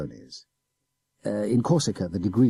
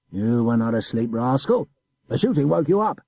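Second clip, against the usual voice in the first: gruff officer, louder on the orders; scolding adjutant; 4 semitones higher, 5 dB louder than usual. A narrator's reading. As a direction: scold the sly boy.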